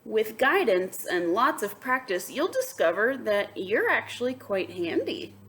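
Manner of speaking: bright read-aloud to the class; lively storybook voice; story narration. A narrator's reading. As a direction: read it like a picture book to kids. Reads picture book aloud.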